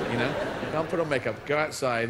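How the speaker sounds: ordinary delivery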